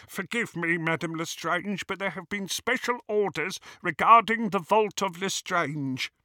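high-pitched, apologetic goblin; deferential goblin. A narrator's reading. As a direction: explain politely in a high goblin voice. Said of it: "he said with a bow".

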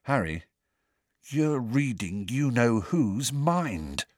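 slow, hushed and a bit frightened; scared and impressed. Acted. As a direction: say it slowly, half afraid, half amazed. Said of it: "sounding both scared and impressed".

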